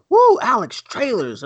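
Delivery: high, loud hyped-up whoop; sudden giddy yelp; excited whoop. Retold or imitated. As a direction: cheer him on for the trailers. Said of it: "and then I'm like".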